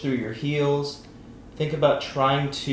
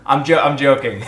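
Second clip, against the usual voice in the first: laughing it off, louder; 3 semitones higher, 10 dB louder than usual. Real, not acted. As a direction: say it through a laugh. Laughs off his teasing.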